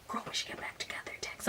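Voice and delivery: fast, breathless whispered aside; mock whisper. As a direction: hush it like a juicy secret. Whispering the text's content.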